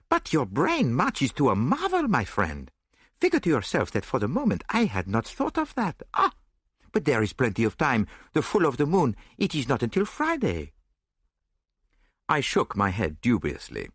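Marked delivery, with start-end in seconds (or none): 0.1-10.7 put-on animated foreign-accented detective, higher pitched; admiring, excited accented voice; Poirot, admiring. A narrator's reading. Detective praising his friend's brain, admiring accented voice. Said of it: "regarding me with admiration".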